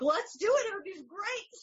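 high, bright eager yes; excited. Retold or imitated. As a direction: gush it with enthusiasm. Husband's eager reply, retold.

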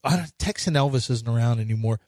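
ordinary talk, nothing marked

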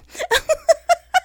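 bright burst of laughter at herself; real amused laugh; high laugh. Real, not acted.

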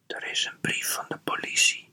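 hushed whisper, all breath. A narrator's reading. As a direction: whisper it secretly, as a warning. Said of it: "fluisterde Dien".

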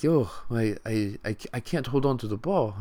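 lightly put-on foreign accent, hesitant; bad African accent. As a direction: stumble through it in a weak African accent. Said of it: "This is my horrible, like, accent".

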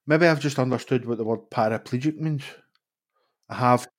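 ordinary talk, nothing marked

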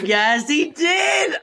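big drawn-out hammed-up Daniel Craig impression; mock Southern detective drawl, stretched slow. Mocking her date to a friend, hammed-up Daniel Craig. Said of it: "He did sound like Daniel Craig".

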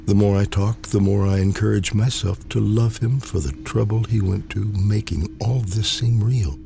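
plain reading voice

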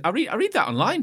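high, loud, keen passer-by; imitated eager fan. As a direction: imitate an excited reader, pitch up.

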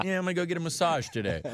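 lightly mocking, easygoing quote; mock carefree friend. Teasing impersonation of friend's carefree plan.

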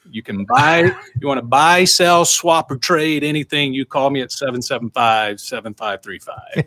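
put-on old-school radio announcer pitch. Shown as a demonstration. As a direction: sell it like a local AM radio host. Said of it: "Let's hear your spiel in your radio voice".

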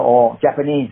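usual speaking voice

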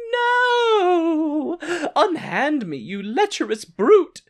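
high, loud damsel-in-distress cry; hammed-up outraged maiden; melodramatic refusal. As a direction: wail the no, then rebuff theatrically. Sibel rejects Earl, acted.

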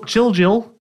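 "Chill Jill" repeated high, word by word; careful name reading. Real, not acted. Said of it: "I haven't got a speech impediment".

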